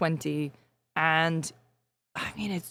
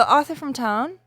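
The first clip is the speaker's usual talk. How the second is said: Northern English accent; 6 semitones higher, slower than usual. Shown as a demonstration. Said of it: "let [name] do it in her accent".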